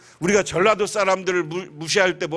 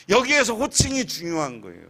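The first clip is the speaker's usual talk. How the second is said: usual speaking voice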